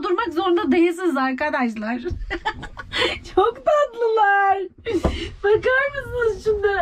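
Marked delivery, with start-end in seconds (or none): 3.3-6.8 slow, drawn-out high coo; high adoring squeal; gushing adoration. Real, not acted. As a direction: stretch it out, melting at the cuteness. Gushing over the pets.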